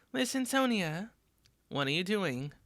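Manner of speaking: ordinary talk, nothing marked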